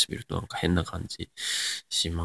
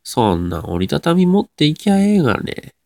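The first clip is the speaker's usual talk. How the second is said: higher-pitched Nagoya dialect retort; 名古屋弁; 7 semitones higher, 4 dB louder than usual. Shown as a demonstration. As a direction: answer in broad Nagoya intonation. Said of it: "名古屋弁っぽい言葉を喋ってみて". (高めの声で名古屋弁っぽく)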